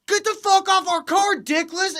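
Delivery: very high, loud jeering woman; high-pitched imitated abuse; mock trash talk. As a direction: heckle shrilly, imitating a loudmouth girlfriend. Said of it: "talking mad shit".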